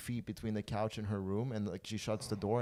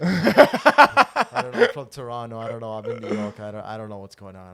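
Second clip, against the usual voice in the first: put-on dazed drunk; 13 dB louder than usual. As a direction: sound lost and wasted. Bewildered drunk answer.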